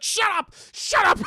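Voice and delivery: high, very loud mock outrage; exasperated shout. Silencing the teasing gargoyles, acted exasperated shout. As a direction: yell each one to silence them.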